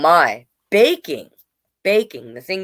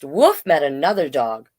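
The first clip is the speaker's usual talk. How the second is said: usual speaking voice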